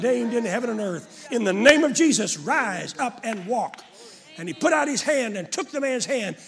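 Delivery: loud, rising pulpit voice that drives every line home; fiery preacher's call to use authority; preaching at full force. Acted. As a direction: preach it hard to the congregation. Preacher retells healing at full force.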